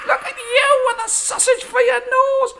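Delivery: wife laughing at her husband; laughing taunt. Acted. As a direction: tease him while still laughing.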